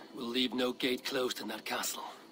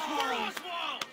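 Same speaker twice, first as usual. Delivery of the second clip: high shouted war cry; rallying chant to the fallen king; battle cry; 11 semitones higher, slower than usual. Acted. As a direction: lead the crowd in the cry. War cry repeated by leader.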